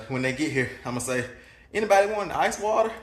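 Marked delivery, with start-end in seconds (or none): 1.7-2.9 higher-pitched, innocent-sounding question; feigned casual offer. Pretend. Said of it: "like I'm just twiddling my thumbs".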